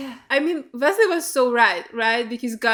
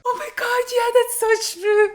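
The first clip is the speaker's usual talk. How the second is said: excited; 9 semitones higher, 3 dB louder than usual. Real, not acted. Excited recognition while watching.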